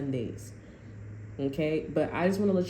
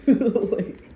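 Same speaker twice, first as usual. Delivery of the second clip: laughing; 5 semitones higher, 7 dB louder than usual. Real, not acted. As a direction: burst out laughing on the word.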